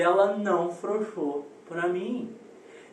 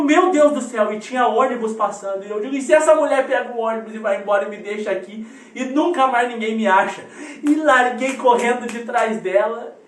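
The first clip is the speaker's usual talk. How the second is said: loud, higher, urgent storytelling; retold panic; 4 semitones higher, 8 dB louder than usual. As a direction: act out a child's mounting alarm at being left. Retold child's panic at being left.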